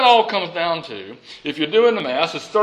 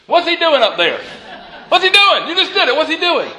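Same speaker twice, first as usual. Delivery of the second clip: excited; 8 semitones higher, 4 dB louder than usual. Real, not acted. Playful call to congregation.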